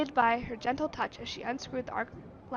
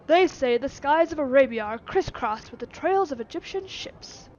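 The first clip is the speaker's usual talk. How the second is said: booming voice; 9 dB louder than usual. Acted.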